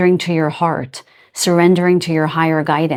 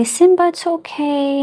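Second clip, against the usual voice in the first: much higher, sing-song comforting voice; soothing a pet; 11 semitones higher than usual. Retold or imitated. Owner calming a cat afraid of men, high and soothing. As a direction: coo reassurance to a frightened cat. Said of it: "one of my cats is very afraid".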